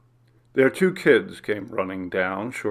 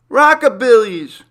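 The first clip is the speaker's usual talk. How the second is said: much higher, much louder happy squeal; delighted squeal; 13 semitones higher, 12 dB louder than usual. Acted. Young daughter picking the restaurant, delighted high squeal. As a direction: squeal the name with glee. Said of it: "Trish squealed with delight".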